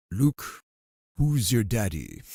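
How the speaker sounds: a hint of slow Vader menace; Darth Vader. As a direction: drag it out like a mock Sith lord. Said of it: "Darth Vader".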